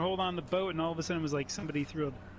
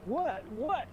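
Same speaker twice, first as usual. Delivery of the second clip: high-pitched, questioning "what"; puzzled, pitch leaping up on the repeat; mock bewildered shout-out; 7 semitones higher than usual. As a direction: imitate a buddy who can't make it out. Acting out buddy's clueless reply.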